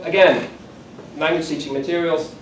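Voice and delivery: ordinary talk, nothing marked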